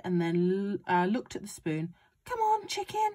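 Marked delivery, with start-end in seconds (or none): 2.2-3.1 high-pitched coaxing of a hen. A narrator's reading. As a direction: urge the chicken closer.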